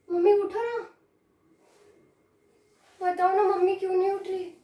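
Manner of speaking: put-on high, puzzled child's voice, repeatedly coaxing; childlike pleading with rising calls; pleading little girl. Child trying to wake mother and sister, pleading.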